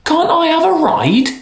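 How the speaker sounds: put-on very high childlike plea; pleading prince. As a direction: beg for a ride like a small boy.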